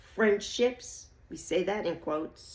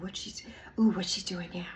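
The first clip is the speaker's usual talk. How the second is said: whispered snooping; nosy whisper; 2 semitones lower, 4 dB quieter than usual. Retold or imitated. Imitating nosy watcher.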